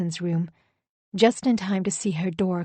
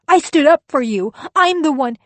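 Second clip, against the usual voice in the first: high, loud angry shout; shouting back; 8 semitones higher, 9 dB louder than usual. Acted. Wife shouts back at her husband, loud and angry. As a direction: throw it straight back at him.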